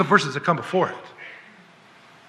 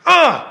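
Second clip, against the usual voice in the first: disgusted groan; 10 semitones higher, 3 dB louder than usual. Real, not acted. Disgust at himself.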